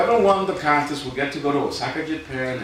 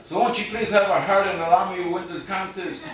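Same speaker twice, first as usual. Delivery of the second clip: hammed-up begging, rising higher to a laughing finish; mock plea; 6 semitones higher, 5 dB louder than usual. Poet begging the contest judges to let him win, mock pleading.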